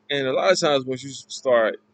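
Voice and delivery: usual speaking voice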